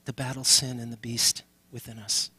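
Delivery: ordinary talk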